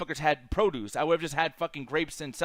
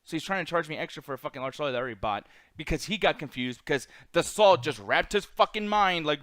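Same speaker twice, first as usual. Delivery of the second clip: normal talking voice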